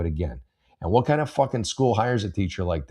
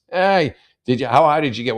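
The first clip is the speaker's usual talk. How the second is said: mock questioner; 5 semitones higher, 7 dB louder than usual.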